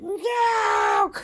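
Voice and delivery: very high shouted no; girl's shout. Acted. Girl fighting the blanket, very high shout. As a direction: scream it while struggling.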